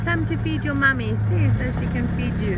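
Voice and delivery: high sing-song calling; high cheerful come-come call to the cows; cooing to cows. Real, not acted. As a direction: coax the herd in a high pet voice. Greeting calf and cows.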